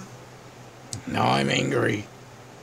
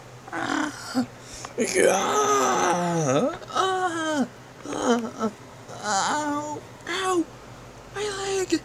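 high cries, fading quieter; pained cries; 4 dB quieter, slower than usual. Acted. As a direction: howl about the hurt leg. Villain in pain, leg hurt.

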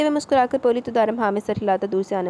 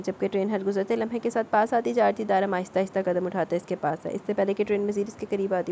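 ordinary delivery

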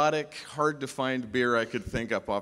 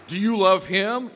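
pushy question; 7 semitones higher, 9 dB louder than usual. Retold or imitated. Ken questioning Julie.

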